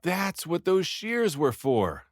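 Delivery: eureka, slowing and rising high; excited shout of discovery. A narrator's reading. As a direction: blurt out the realization. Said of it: "I shouted to them".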